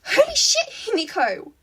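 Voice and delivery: startled yell, high and loud; startled yelling at a friend. A narrator's reading. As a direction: yell it in shock. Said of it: "Ochako yelled".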